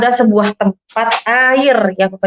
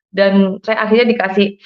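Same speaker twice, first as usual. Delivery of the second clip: plain reading voice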